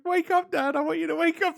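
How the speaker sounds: high, hammed-up pleading cub; mock tearful plea; pleading Simba. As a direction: Simba begging his dad to wake, mock weepy. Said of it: "the scene where Simba is like trying".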